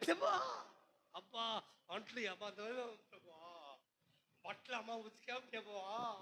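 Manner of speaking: mock a lazy believer mumbling excuses; mock whiny prayer. Preacher mocks a churchgoer's feeble Sunday prayer, mumbling softly.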